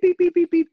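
high, loud electronic beeping; imitated device beeps. Imitating the comp device beeping all day, high and loud. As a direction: mimic a gadget chirping at you.

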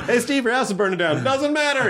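high-pitched comic call and carefree reply. Retold or imitated. Calling out bad news to the banjo player, high mock-carefree voice. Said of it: "the old [name] bit".

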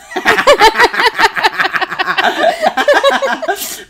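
loud high laughing fit at own slip; burst of laughter. Real, not acted. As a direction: cracks up loudly at the misread word.

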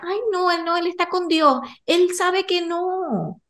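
high-pitched, drawn-out feigned reassurance; hammed-up dodge, stretching the final words; mock pious protest. Mother imitating the evasive answer she won't give her son, mock protest.

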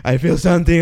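winded panic after running; out of breath. Retold or imitated. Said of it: "and I'm, like, dying".

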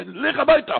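high commanding shout. Retold or imitated. Rebbe shouting at the scholar to go home. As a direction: order him home sharply. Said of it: "צעק עליו".

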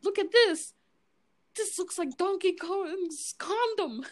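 high mock-chef disbelief; Gordon Ramsay. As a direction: imitate an appalled chef, slowing on the punchline. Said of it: "He's all like".

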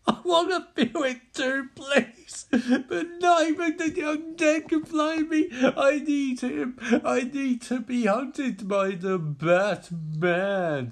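high, desperate tearful wailing; crying villain. Acted. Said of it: "as Scarecrow starts crying".

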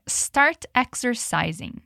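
"start exercising" said very slowly and clearly; slow model phrase. Shown as a demonstration. Teacher models "start exercising" very slowly for students. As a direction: drag out "start exercising" for repeating. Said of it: "E agora diga".

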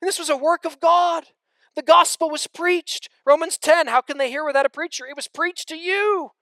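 loud, high, driving sermon delivery; fiery pulpit voice; full-force preaching. Acted. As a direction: preach it out to the whole room.

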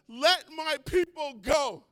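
loud slow proclamation; Moses demanding. Acted. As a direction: call it out to the king. Moses confronts Pharaoh.

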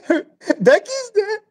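high-pitched hysterical mock sobbing; retold sobbing. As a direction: imitate a girl blubbering through her words. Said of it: "and she's sobbing".

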